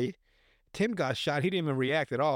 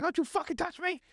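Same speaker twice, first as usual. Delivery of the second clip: angry. Retold or imitated. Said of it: "So Dan gets mad".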